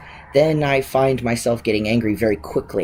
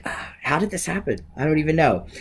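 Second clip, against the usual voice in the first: high, soft exclamation of disbelief; mock bewilderment; 5 semitones higher, 4 dB quieter than usual. Reacting to every year that goes by, mock bewildered. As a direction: voice a bewildered reaction, higher and softer. Voicing yearly bewilderment at ageing.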